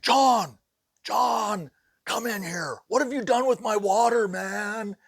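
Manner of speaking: high, loud panicked yelling; frantic shouting. Retold or imitated. Sheetrocker calling the boss about his missing water: high panicked yell. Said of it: "my sheetrocker had a total fit".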